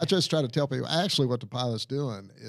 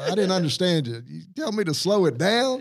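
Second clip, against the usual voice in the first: slow, louder playful Southern pilot; acting dumb on the radio, higher and louder; mock Southern drawl; 6 semitones higher, 5 dB louder than usual. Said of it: "Say, uh".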